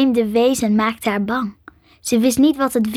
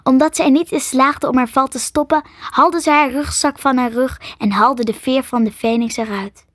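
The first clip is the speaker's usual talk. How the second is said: ordinary delivery, nothing marked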